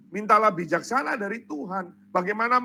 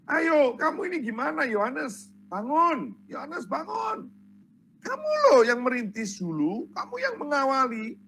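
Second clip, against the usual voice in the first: higher-pitched, pleading prodding; acted wake-up call, voice pitched up; urgent goading; 5 semitones higher than usual. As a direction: rouse him as an agitated follower. Acted followers goading John to act.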